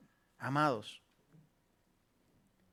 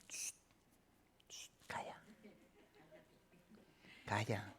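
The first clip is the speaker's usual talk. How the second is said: whispered "shh, calla"; soft whispered shushing; hushing whisper; 3 semitones lower, 15 dB quieter, slower than usual. Shown as a demonstration. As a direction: hush the soul like a child. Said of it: "hay que decirle".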